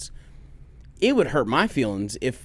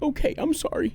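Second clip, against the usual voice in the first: put-on little girl voice; 13 semitones higher, 4 dB quieter than usual. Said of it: "she's like".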